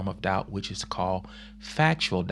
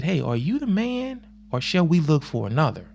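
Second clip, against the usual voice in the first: doubting John; 7 semitones higher than usual. Retold or imitated. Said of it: "you tell Jesus I said".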